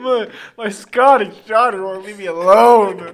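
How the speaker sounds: loud, high Texan wail; slow, loud Texan holler; mock Texan mother. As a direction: complain in a hammed-up Texan voice. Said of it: "you're Texan".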